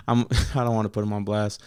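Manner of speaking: words broken by real laughter; cracking up mid-sentence; laughing while talking. Real, not acted. Laughing at bathroom story.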